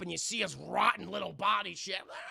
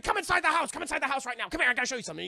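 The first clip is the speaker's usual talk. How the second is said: higher, louder urgent call; urgent excitement; 7 semitones higher, 6 dB louder, much faster than usual. Retold or imitated. Wife urging her husband inside, high urgent voice. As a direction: hurry him inside breathlessly.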